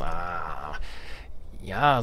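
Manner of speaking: ordinary delivery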